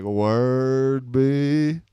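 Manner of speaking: a hint of a slow laid-back drawl; lightly imitating Boogie. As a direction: echo the friend's relaxed way of talking. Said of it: "talked hella slow like he was from the South".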